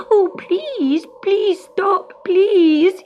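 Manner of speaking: a terrified kitten pleading; crying plea. Acted. Gobelino begging the witch to stop, high and afraid. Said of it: "he cried".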